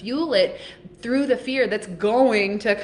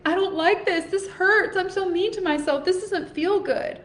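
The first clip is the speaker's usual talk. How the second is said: much higher, strained voice imitating women close to tears; imitating tearful women; 10 semitones higher than usual. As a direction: mimic the retreat women hurting, up high and pinched. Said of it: "They're like".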